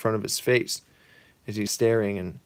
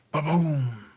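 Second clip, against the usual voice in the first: mock explosion sound; a playful ba-boom blast; explosion noise; 5 semitones higher than usual. Imitating the package bomb, playful boom.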